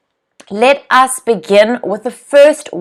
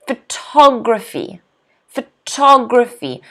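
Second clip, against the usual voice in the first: "photography" with TOG stressed, falling after; stress on second syllable. Shown as a demonstration. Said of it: "stress is on the second syllable".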